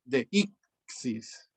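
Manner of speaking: de ixis spelled out slowly, de, í, xis; slow syllable split. Shown as a demonstration. Teacher slowly syllabifying for students the word de ixis. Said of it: "la sílaba de, la sílaba I y la sílaba sis".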